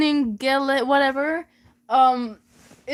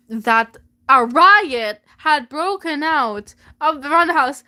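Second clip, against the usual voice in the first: ordinary talk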